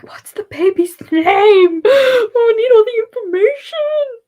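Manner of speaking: high, loud happy wail; overcome, squealing high and slow; tearful excitement. Real, not acted. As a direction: beg for news, drawn out and near tears. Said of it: "I might actually start crying".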